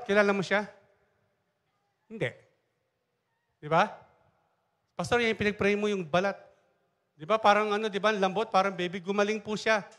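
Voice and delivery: ordinary talk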